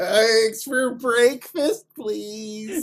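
very slow, high drawn-out "Eggs for breakfast"; slow, high mock regional plea. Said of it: "I don't say breakfast".

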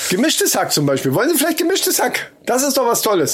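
chirpy shop counter pitch; butcher sales pitch. Pretend. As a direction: sell the meat like a cheerful butcher. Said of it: "rede wie ein Fleischverkäufer".

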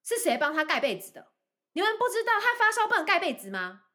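high, shrill-pitched accusing questions; mock accusing mother. Mother blaming teachers for the blanket, high accusing voice. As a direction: scold the teachers as the indignant mom. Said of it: "他就说".

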